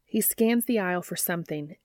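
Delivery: ordinary delivery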